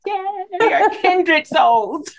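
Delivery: high, drawn-out gleeful exclamation; excited, stretched-out cry of kinship; delighted agreement. Real, not acted. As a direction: gush slowly with real delight. Delighted agreement over dark chocolate.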